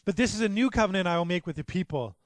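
ringing pulpit reading of scripture. Acted. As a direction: proclaim the prophecy with rising fervor. Quoting Jeremiah, raised.

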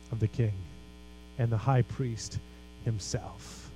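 low, soft, with weighty pauses; hushed reverence. Real, not acted. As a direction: drop to a hush and let each phrase land. Hushed climax after fervent build.

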